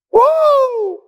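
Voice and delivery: a sharp, very high "woo" of delight; high excited whoop. Real, not acted. Preacher firing up the church, high whoop. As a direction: whoop it like the word is fire.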